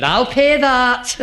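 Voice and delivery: slow, high and loud Scottish miser; mock Scotsman. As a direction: stretch it out like a proud Scotsman offering to pay. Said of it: "Scotsman said".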